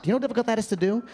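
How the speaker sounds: ordinary talk, nothing marked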